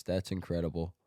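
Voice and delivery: usual speaking voice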